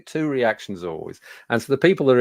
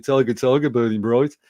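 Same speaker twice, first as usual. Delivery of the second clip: thick Birmingham accent, firm and louder on the verse; Brummie accent; 4 dB louder than usual. Shown as a demonstration. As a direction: recite it in a broad Brummie accent. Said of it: "with my best Brummie accent".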